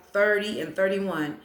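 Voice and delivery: normal talking voice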